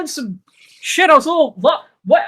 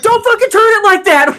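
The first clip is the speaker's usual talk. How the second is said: higher, louder mock scolding; mock yell; 8 semitones higher, 4 dB louder than usual. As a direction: yell at the friend in jest. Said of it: "I'm just sitting there like".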